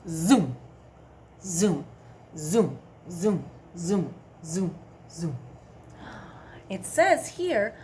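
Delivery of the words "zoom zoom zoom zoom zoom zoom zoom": zippy zoom sounds; zooming dragonfly. Shown as a demonstration. Teacher zooming like a dragonfly for kids. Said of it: "Can you say zoom?".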